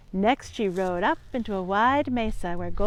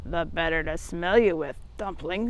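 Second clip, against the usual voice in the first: lower, softer sly wolf; 5 semitones lower, 6 dB quieter than usual. Acted. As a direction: purr it like a hiding wolf. Wolf in grandma's bed.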